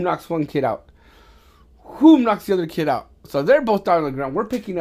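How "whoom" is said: loud, explosive "whoom"; booming vocal sound effect, pitched up; imitated punch whoosh. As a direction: punch out a big whooshing impact noise. Imitates the second knockout punch.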